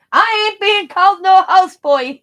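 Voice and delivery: loud, high, put-on protest; hammed-up refusal, thrown out loud; husband loudly refusing the houseboy label; indignant husband. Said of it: "he says".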